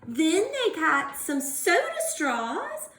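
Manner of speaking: usual speaking voice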